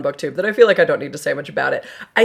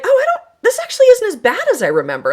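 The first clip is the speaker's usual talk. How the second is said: pleasantly surprised; 4 dB louder, faster than usual. Retold or imitated. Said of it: "and I was like".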